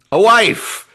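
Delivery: high, loud mock-triumphant shout; exclaimed punchline. Host answers his own rhetorical question, hammed-up shout.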